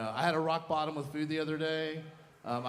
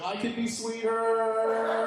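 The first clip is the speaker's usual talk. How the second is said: high, drawn-out singsong; yearning bread voice; 8 semitones higher, 3 dB louder, much slower than usual. Acted. Loaf of bread wishing aloud, high drawn-out voice. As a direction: stretch it out high and slow.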